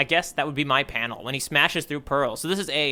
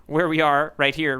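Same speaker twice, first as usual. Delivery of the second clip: ordinary talk, nothing marked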